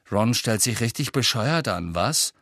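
ordinary delivery, nothing marked

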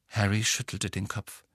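ordinary delivery, nothing marked

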